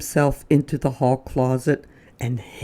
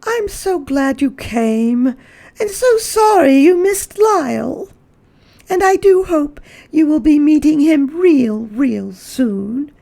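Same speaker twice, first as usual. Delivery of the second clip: high, louder gushing hostess; Mrs Prim saying goodbye; 13 semitones higher, 5 dB louder than usual. Acted. Mrs Prim bids her guest goodbye, high and gushing. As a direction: gush politely at a departing guest. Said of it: "And Missus Prim say".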